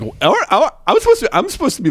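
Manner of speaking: high, stammering bewilderment; confused protest. Retold or imitated. New hire to himself while pulled off waiting tables, confused protest. Acting out confusion mid-task.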